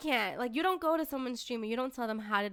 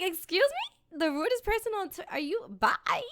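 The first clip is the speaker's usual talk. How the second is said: outraged disbelief; 8 semitones higher than usual. Retold or imitated. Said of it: "I was like".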